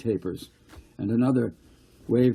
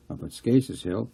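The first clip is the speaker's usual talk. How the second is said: plain reading voice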